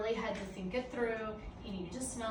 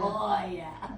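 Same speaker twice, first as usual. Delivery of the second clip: laughing, contented dog voice; blissful dog voice; 7 dB louder than usual. Retold or imitated. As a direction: voice the dog's pleasure. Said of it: "he's like".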